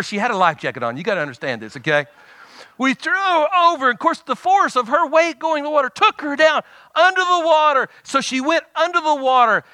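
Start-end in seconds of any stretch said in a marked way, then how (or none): 2.8-9.6 high-pitched, animated retelling; excited storytelling. Real, not acted. Preacher retelling his sister's plunge, high and excited. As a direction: pitch it up and push the drama along.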